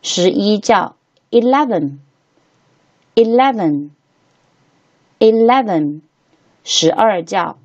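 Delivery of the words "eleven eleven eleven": eleven slow and careful, pauses between repeats; slow model reading. Shown as a demonstration. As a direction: say eleven drawn out for learners. Teacher models number for repetition.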